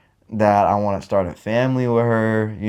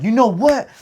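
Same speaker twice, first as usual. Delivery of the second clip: much higher, exasperated snap; frustrated outburst; 7 semitones higher than usual. Retold or imitated. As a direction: throw up your hands, fed up. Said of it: "I'm over here getting frustrated".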